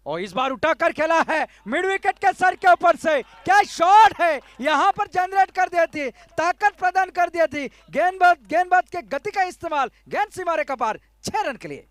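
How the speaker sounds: loud, high roar over a big six; excited cricket commentary. Real, not acted. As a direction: shout the shot with full thrill. Six hit in live commentary.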